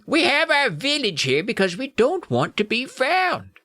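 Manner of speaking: high, slow, squeaky little creature; squeaky gnome. Acted. Gnome explains the hidden village, squeaky. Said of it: "in his squeaky voice".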